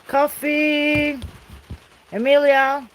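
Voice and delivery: high, loud call across the yard; yelling out. Real, not acted. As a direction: shout it out so she hears you inside. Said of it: "I just yell out here".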